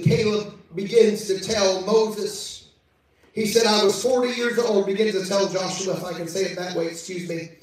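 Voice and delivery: ordinary talk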